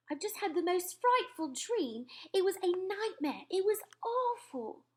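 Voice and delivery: a queen still upset by a nightmare; queen's voice. Acted. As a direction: tell the dream as a rattled queen. Queen recounts her nightmare.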